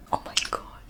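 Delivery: breathless whispered swoon; awed whisper. Real, not acted. Reader swooning at a kiss scene, whispered. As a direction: whisper it like you can't handle the scene.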